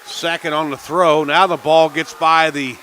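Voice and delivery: loud, high, rapid-fire call as the run scores; excited play call. Real, not acted. Announcer shouting the big play to radio listeners, excited and loud. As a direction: let it erupt as the ball gets away.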